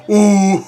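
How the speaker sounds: high, drawn-out ooh; impressed "ooh". Real, not acted. Reacting to a bold line.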